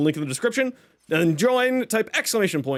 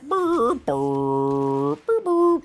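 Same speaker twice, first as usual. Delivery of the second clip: loading noise; 3 semitones lower, much slower than usual. Retold or imitated. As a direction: make a goofy stalling sound. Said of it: "it's just like".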